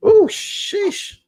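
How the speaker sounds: high startled "Ooh, sheesh"; surprised ooh. Real, not acted. Reviewer jolts as spray hits his mouth, startled high yelp. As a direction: yelp up high, caught off guard.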